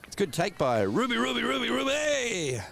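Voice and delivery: rising excited cheer; calling out the name, building up. Real, not acted. Excited call as Ruby takes catch.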